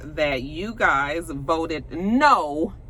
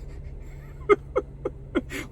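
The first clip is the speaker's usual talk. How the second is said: breathy laugh at her own joke; amused laugh; 6 dB quieter than usual. Real, not acted. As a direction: burst of high giggling.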